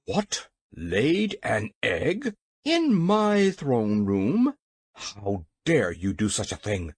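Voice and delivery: slow, outraged exclamation, word by word; furious. A narrator's reading. King outraged at an egg in his throne room, furious. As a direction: draw out the outrage, swelling mid-speech. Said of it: "asked the King in a voice of fury".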